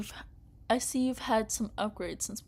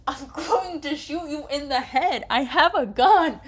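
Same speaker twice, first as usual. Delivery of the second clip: loud, higher enraged yell; furious shout; 7 semitones higher, 11 dB louder than usual. Real, not acted. Player raging at the villain, furious shout. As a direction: scream the threat at the screen.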